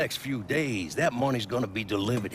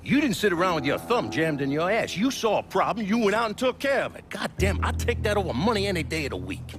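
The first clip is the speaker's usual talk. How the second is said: fired-up praise; 8 semitones higher than usual. Acted. Boss praising fiercely.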